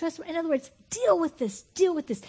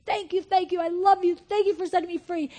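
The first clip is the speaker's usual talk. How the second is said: higher, louder thankful cry; rising joyful prayer; 6 semitones higher, 5 dB louder than usual. Acted. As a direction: let the thanks burst out.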